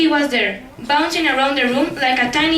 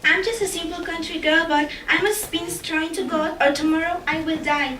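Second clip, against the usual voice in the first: put-on higher, gentle girl voice; timid girl; 3 semitones higher, 5 dB quieter than usual. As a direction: confide your plight in a small voice. Girl tells her plight.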